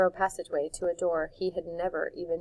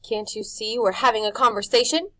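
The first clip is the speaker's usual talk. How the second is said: spat; 4 semitones higher, 7 dB louder than usual. A narrator's reading. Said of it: "he spat".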